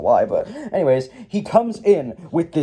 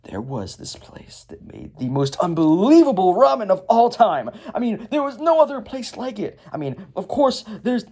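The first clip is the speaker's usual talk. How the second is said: dramatic gushing, rising high; hammed-up villain reminiscence; Garmadon; 8 semitones higher than usual. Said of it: "dramatic music starts playing".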